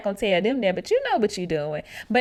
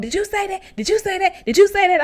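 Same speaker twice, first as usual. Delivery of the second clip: high, pressing repeat, building louder; insistent repeated question; 9 semitones higher, 4 dB louder than usual. Retold or imitated. True going around asking housemates, insistent and high. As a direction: keep hammering the same question.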